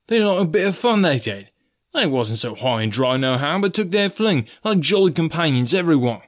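high, swinging sailor voice; Israel Hands. A narrator's reading. Coxswain praising jolly companions to the ringleader, high and lively. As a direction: recall old shipmates with gusto.

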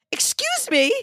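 high, indignant mock outrage; indignant excuse me. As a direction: act affronted at being shoved aside. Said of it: "I'm, like, going".

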